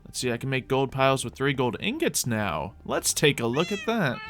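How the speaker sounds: ordinary talk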